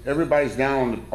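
ordinary delivery

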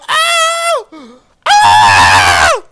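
loud, drawn-out screaming whoop; triumphant howl. Real, not acted. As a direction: howl it out in victory. Howls after winning.

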